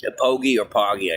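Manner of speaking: ordinary talk, nothing marked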